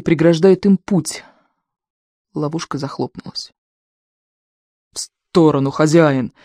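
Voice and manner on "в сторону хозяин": plain reading voice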